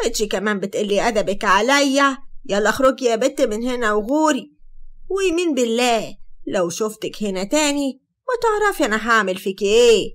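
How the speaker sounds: loud, threatening scolding; acted furious telling-off; angry mother. Mother slaps daughter, throws her out.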